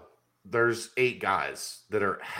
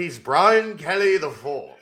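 put-on Old English accent; 7 semitones higher, 4 dB louder, slower than usual. Old English bit continues.

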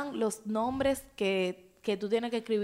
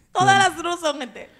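repeats it higher, laughing; laughing, repeated louder; 6 semitones higher, 6 dB louder than usual. Real, not acted. Repeating the joke to friends while laughing.

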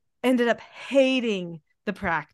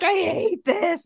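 mock meltdown; 7 semitones higher, slower than usual. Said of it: "I'm like".

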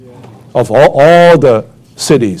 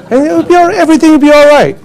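mock carefree; 13 semitones higher, faster than usual. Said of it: "the typical saying in Australia".

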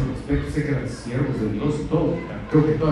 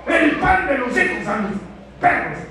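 scornful dismissal; 11 semitones higher than usual. Retold or imitated. Disciples dismissing the foreign woman, mild scorn. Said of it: "Como decían".

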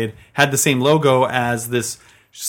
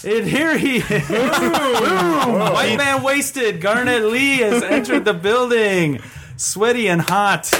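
hype announcer; 9 semitones higher, much slower than usual. Acted. Hyping a colleague's entrance.

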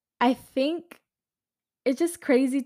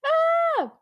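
high squeal; 4 dB louder than usual. Real, not acted. Reacting to sad slideshow quote.

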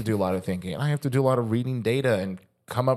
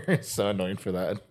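ordinary delivery

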